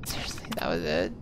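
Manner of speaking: disappointed whisper. Real, not acted. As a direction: breathe it out, let down. Let down by a small reward.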